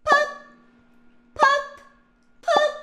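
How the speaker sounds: excited popping sounds. Acted. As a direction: pop each bubble with glee. Bubble popping again.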